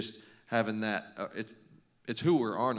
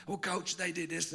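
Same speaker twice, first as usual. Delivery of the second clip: put-on high-pitched voice of a complaining kid; protesting kid; 9 semitones higher than usual. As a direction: plead your case to the coach, pitched high.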